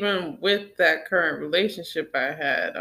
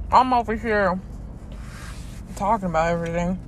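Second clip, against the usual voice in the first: ordinary talk, nothing marked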